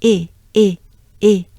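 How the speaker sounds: short, loud é for each E; punchy separate repetitions of E as "é"; French é sound. Shown as a demonstration. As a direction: drill E as the é of café. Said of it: "E is the sound we're looking for".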